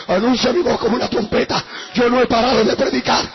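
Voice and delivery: loud, driving testimony; preaching at full force. Real, not acted. Preacher declares healings to the congregation, full-force preaching.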